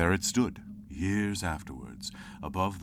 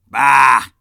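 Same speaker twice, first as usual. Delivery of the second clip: very loud explosive scoff; angry bah; 8 semitones higher, 21 dB louder than usual. Acted. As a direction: blast it out.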